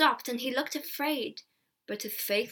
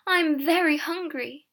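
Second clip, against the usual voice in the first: higher, louder plea; calling; 5 semitones higher, 5 dB louder than usual. A narrator's reading. Hungry girl pressing the peddler for money, calling. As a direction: call out pleadingly. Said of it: "Alison called again".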